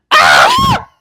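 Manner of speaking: high, loud yelp of fright; startled scream. Retold or imitated. Reenacting yelp when the hamster bit, loud scream. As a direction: shriek like you've just been bitten. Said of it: "And I did that shit".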